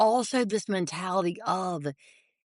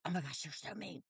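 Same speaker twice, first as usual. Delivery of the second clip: put-on sulky teen muttering; 16 dB quieter, faster than usual. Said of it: "I'm so mad".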